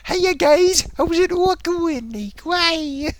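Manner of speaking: high-pitched mock-cheery hello; chirpy video greeting. Imitating streamer greeting viewers on videos, over-jolly. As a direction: greet the fans in a silly high voice. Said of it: "on his other videos, he's like".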